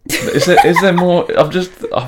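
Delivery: high and loud, half laughing; laughing mock indignation; laughing protest. Real, not acted. Teased, asks laughing in protest.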